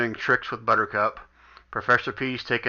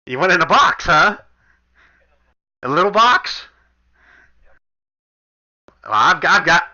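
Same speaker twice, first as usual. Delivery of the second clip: teasing shopkeeper; 6 semitones higher, 4 dB louder than usual. Acted. GM voices flirty shopkeeper.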